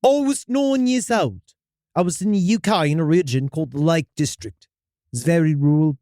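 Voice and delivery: storyteller in a put-on British accent; hammy English-accented first-person tale. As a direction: read it with an amateur British accent. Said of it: "when in that accent".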